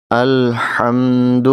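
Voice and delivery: الحمد drawn out slowly, each sound clear; slow correct recitation. Shown as a demonstration. Teacher showing students the correct slow الحمد. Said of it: "درست طریقے سے پڑھنا".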